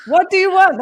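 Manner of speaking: high, loud startled what; imitating a stunned client caught off guard; shocked client.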